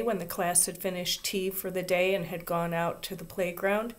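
ordinary delivery, nothing marked

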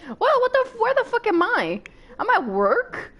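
high, loud shocked outburst; startled confusion. Real, not acted. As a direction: blurt it out in disbelief.

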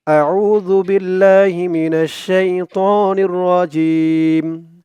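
slow melodic recitation; Quran recitation. Acted. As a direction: recite slowly with chant. (ধীর সুরেলা তিলাওয়াত)

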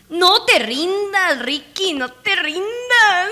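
high, loud, drawn-out plea; pleading rally cry. Pretend. Player begging Ricky not to give up, loud plea. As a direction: urge them on at full voice, stretching words. Cheering game character not to quit.

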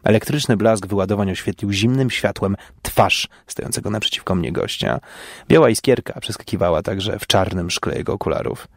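plain reading voice

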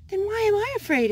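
high, quick frightened small voice; worried Skunk. Acted. Skunk asking Raccoon why he is scared, high and fretful. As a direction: squeak it out, anxious. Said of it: "asked Skunk".

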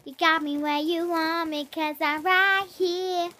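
high squeaky chipmunk voice; helium voice. Real, not acted. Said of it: "I sound like a chipmunk".

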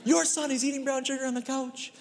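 high-pitched mock-exasperated complaint; dad passing the blame to his wife. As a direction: point at her and disown the kid. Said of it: "I'm like".

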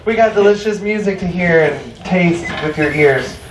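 usual speaking voice

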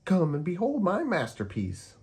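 Kitty unveiling her masterpiece; Kitty voice, proud. Acted. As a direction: show off the painting proudly. Kitty character voice.

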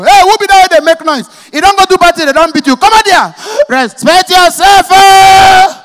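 loud, high scolding shout; angry neighbor yelling. Retold or imitated. Woken neighbor shouting at the crying child. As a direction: tell the noisy kid off.

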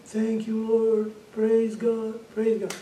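imitated weak woman praying, pitched high; retold high, halting thanks; feeble praise. Dying woman repeats the praise.

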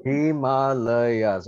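very slow, stretched syllables. Shown as a demonstration. Teacher checking a student's word, slow syllable-by-syllable. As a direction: draw out each syllable of হিমালয়াছ. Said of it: "অকল চাৰিটা আছে তাত".

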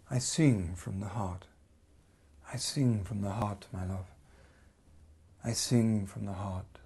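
dramatic poetry reading; poem recitation. Acted. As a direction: recite it like a love song spoken aloud. Whole piece a recited love poem.

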